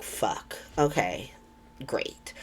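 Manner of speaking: whispered, defeated mutter; deflated whisper. Retold or imitated. Anna taking in the overdose news, whispered and deflated. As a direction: breathe it out under your breath, beaten.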